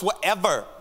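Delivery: put-on playful take on a sassy girl voice. Shown as a demonstration. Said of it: "say it in your best sassy girl voice".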